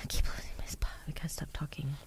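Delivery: whispered aside to herself. Real, not acted. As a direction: whisper it under your breath. Loses place while reading aloud.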